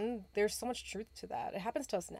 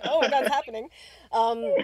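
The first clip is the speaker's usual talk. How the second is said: laughing, louder panicked exclamation; nervous laugh; 5 semitones higher, 12 dB louder than usual. Retold or imitated. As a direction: blurt it out through nervous laughter. Laughing disbelief at events.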